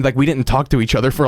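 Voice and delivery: ordinary talk, nothing marked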